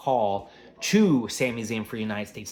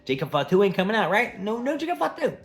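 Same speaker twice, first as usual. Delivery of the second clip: mocking taunt; 10 semitones higher, 5 dB louder than usual. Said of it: "Drew McIntyre's like".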